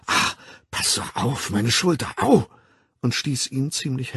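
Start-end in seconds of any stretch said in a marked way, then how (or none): 0.1-2.5 schmerzhaftes Aufschreien. A narrator's reading. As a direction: wince out the words in pain. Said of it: "Linda schrie auf". (gequält aufstöhnen)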